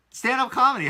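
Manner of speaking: stand-up comedy, high and loud; amazed. Real, not acted. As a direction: say it like you can't believe it. Amazed repeat.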